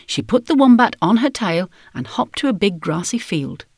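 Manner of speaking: plain reading voice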